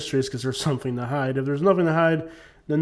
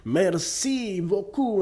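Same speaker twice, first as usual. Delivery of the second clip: slow, higher mock French accent; 6 semitones higher, much slower than usual. Imitating the Canadian leader's French speech, slow, higher, mock French accent. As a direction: drawl it like a bad French impression. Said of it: "He started talking in French".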